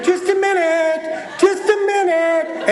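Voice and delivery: high, slow sing-song stalling; stalling kids. Retold or imitated. Kids stalling their dad through the door, high and slow. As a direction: drag it out to buy time. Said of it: "they're going".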